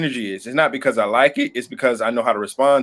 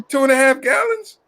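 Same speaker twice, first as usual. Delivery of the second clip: incredulous; 10 semitones higher than usual. Real, not acted. Teasing about water jug.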